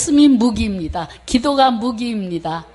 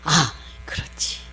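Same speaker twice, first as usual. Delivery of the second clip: slow, breathy inner murmur; a quiet, slow whisper of realization; 4 semitones lower, 6 dB quieter than usual. Retold or imitated. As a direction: breathe it out to yourself. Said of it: "inner prayer quoted".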